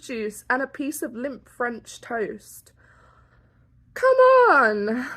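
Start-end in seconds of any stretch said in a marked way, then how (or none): 3.9-5.1 exasperated. Real, not acted. Exasperated at the price.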